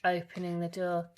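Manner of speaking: ordinary delivery, nothing marked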